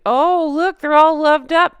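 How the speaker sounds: high, loud sing-song mockery; mock their lovey-dovey act. Vlogger mocking the royal couple's loved-up act, sing-song.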